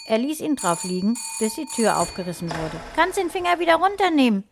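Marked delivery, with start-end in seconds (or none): 3.0-4.4 put-on high, quick, loud little-girl voice; high-pitched child voice, fired off quickly; little sister. A narrator's reading. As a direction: pipe up as a pert six-year-old. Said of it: "meinte seine kleine Schwester".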